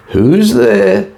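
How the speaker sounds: high, thin frail granny calling out; a very high, feeble old woman's reply. A narrator's reading. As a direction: call out like a weak old grandmother in bed. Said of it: "came a frail old voice".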